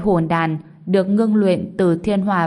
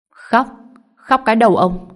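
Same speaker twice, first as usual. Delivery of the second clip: raised, louder angry snap back; loud retort; 4 semitones higher, 5 dB louder than usual. A narrator's reading. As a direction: shout the enemy down scornfully. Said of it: "Mục Vĩ lớn tiếng nạt lại".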